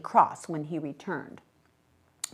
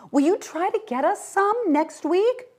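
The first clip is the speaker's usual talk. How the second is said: calling after truck; 4 dB louder than usual. A narrator's reading.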